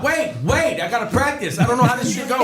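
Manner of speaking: high, laughing panic; high panicked rant. Retold or imitated. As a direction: babble it in high alarm. Panicking about first show.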